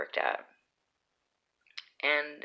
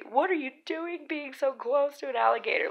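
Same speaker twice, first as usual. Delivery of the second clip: high-pitched mock alarm; frightened moms; 11 semitones higher than usual. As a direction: imitate a worried mother scolding, voice pitched way up. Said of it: "so frightened and stuff".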